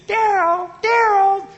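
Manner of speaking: very high, loud squeaky little wife calling out; tiny Minnie Mouse voice. Retold or imitated. Tiny wife calls her big husband, Minnie Mouse voice. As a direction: call his name in a tiny cartoon-mouse falsetto. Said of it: "tiny little Minnie Mouse voice".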